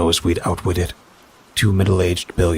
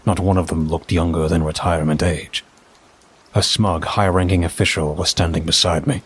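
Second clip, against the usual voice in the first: ordinary delivery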